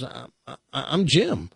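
halting, mildly stunned stammer; speechless stammer. Acted. Buyer answering a talking dog, stammering in mock shock. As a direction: stumble into the name as if dumbstruck. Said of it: "He's so speechless".

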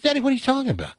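high, puzzled daughter voice; baffled daughter. Acted. Daughter confused by her father's warning, pitched high. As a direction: pitch it up in disbelief.

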